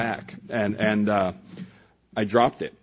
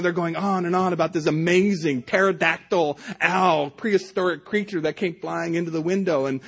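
normal talking voice

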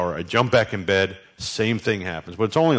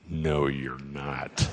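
flat refusal; 6 semitones lower, 7 dB quieter, much slower than usual. Retold or imitated. Refusing wife's hospital plan.

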